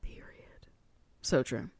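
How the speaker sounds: whispered one word; a soft whispered period. Real, not acted. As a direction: breathe it unvoiced. Whispered agreement.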